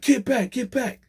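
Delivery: high yelled command; guard yelling. Retold or imitated. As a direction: bark get back at the old man. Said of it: "yelling to the, um, old man".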